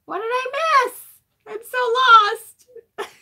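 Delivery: mock Brad. Host playing Brad, who is lost in the chat, in a high whimper. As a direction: whine it like a lost puppy.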